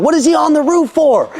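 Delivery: high-pitched mock alarm; incredulous. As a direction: demand an explanation in exaggerated disbelief. Retelling his alarmed reply to wife.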